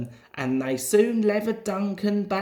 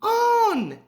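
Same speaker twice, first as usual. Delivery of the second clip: much higher urgent plea; urging; 3 dB louder than usual. A narrator's reading. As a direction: push him to start, pitched high. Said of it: "he urged".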